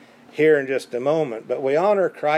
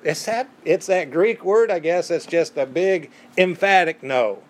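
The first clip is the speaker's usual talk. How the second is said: usual speaking voice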